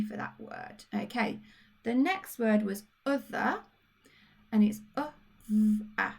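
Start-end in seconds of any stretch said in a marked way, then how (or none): 5.5-6.1 v then a, phoneme by phoneme; v, a split into separate sounds; sounding out. Shown as a demonstration. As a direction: sound out the end of other as v, a. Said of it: "The E and the R make the a sound".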